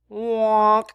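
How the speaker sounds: loud, high "wonk" noise; one loud comic honk; mock honk. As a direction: blurt a honking sound effect. Imitated noise as a punchline.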